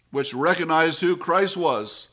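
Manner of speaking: usual speaking voice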